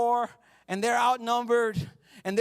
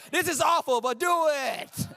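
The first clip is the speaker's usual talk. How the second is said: mock audience member; 7 semitones higher, slower than usual. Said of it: "You're like".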